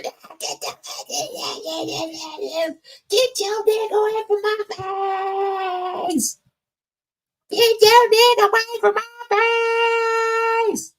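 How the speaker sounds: very high, shrill-sounding mock scream of her words; mock screaming woman. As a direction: parody her screaming in a squeaky falsetto. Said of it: "That's what she was screaming".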